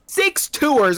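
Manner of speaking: incredulous echo. Real, not acted. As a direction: repeat it in shock. Echoes number in disbelief.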